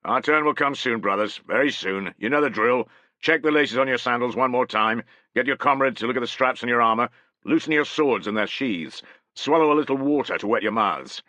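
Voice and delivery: a veteran officer steadying nervous troops; centurion rallying his men. Acted.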